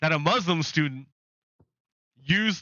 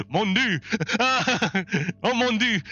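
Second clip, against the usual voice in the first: higher-pitched words through giggles; laughing hard; 6 semitones higher than usual. Real, not acted. Streamer repeating own French pun, helpless laughter. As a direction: gasp out the French phrase while cracking up.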